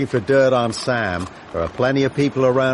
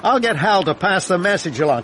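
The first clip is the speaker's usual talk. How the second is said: usual speaking voice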